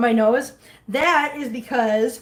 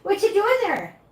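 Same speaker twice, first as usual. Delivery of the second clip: playful high inquiry; cutesy pet question; 9 semitones higher than usual. Real, not acted. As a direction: ask the kitten in a high cooing voice. Teasing question to the cat.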